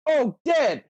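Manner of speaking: high, sudden cry; hammed-up yelp of "dead"; mock alarmed shout. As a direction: play it as a dramatic discovery. Quoting character shouting.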